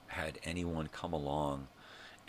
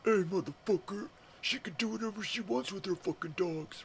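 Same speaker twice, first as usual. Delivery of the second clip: much higher, loud mock neighbor yelling; angry neighbor; 4 dB louder than usual. Imagined neighbor yelling at him, hammed-up mock shouting. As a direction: ham up a furious neighbor. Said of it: "somebody coming out and going".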